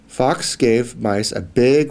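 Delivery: plain reading voice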